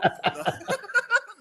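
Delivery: high-pitched burst of laughter; real laughter. Real, not acted.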